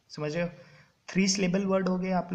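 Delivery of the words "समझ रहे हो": usual speaking voice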